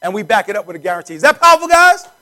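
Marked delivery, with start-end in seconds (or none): 1.1-2.1 much higher and louder, hyping the crowd; rallying shout. Real, not acted. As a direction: pump the room up with the question. Rallying the audience.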